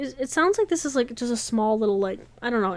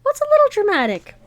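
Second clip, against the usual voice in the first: voiced newcomer; 12 semitones higher, 7 dB louder than usual. New character Owen interrupts.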